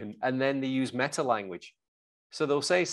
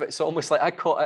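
normal talking voice

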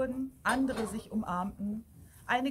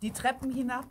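ordinary delivery, nothing marked

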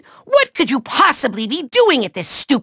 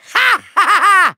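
long, high villain cackle; evil cackle; 3 semitones higher, 4 dB louder than usual. Acted. Villain gloats over a trick up his sleeve, maniacal laugh. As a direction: cackle triumphantly, drawn right out.

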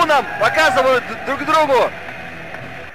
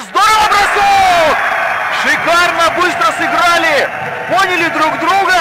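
loud, higher excited praise; excited shout; 3 semitones higher, 4 dB louder than usual. Real, not acted. Goal celebration commentary. (восторженный возглас)

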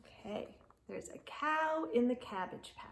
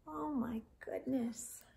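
hushed stage whisper; 2 semitones higher than usual. Acted. Reader whispering to young listeners in mock alarm. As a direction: share a secret with the children.

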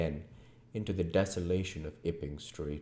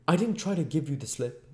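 high, loud tearful protest; high-pitched, upset denial; near tears; 11 semitones higher, 5 dB louder, faster than usual. A narrator's reading. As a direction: plead as if close to crying. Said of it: "not far remote from tears".

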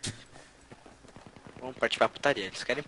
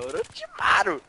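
high squeaky voice; 5 dB louder, slower than usual. Pretend. Shouting name in high put-on voice.

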